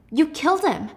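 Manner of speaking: lightly whined accusation; whining. Retold or imitated. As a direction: whine it at him like a hurt teenager. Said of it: "she's whining at him".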